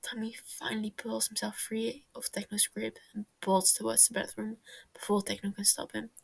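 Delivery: usual speaking voice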